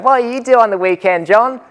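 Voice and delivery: put-on high, taunting kid voice; cheeky kid. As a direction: mimic a rowdy student teasing the teacher. Kids heckling from desks.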